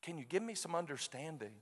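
soft, hushed plea to God; quiet retold prayer. Praying alone to God at night, quietly pleading.

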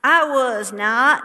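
loud, slow Texas drawl denial; Texas accent. Retold or imitated. Actor in a Texas play denying, thick Texas accent. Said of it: "the Texas accent is so thick".

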